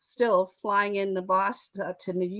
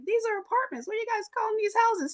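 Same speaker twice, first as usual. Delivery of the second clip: much higher, fast, baffled protest; incredulous; 11 semitones higher, 3 dB quieter, faster than usual. Retold or imitated. Southern teen questioning New Englanders about row houses, incredulous. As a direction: ask it like the idea makes no sense at all.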